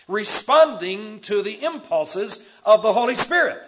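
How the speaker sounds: loud, rising sermon delivery; full preaching. Acted. Preacher exhorting the church to follow the Spirit, loud and rising. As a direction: build the call phrase by phrase.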